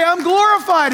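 jubilant Billy Graham. Acted. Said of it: "He would say".

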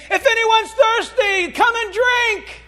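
high, slow, ringing call; Jesus calling out. Acted. Jesus calling to the thirsty crowd, high and slow.

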